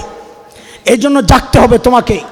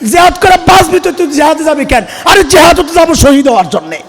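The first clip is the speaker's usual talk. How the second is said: ordinary talk, nothing marked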